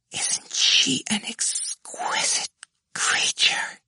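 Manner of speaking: slow, high, whispered adoration; devoted murmur. A narrator's reading. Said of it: "murmured devotedly".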